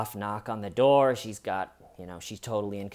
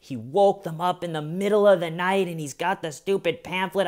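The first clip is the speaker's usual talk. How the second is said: usual speaking voice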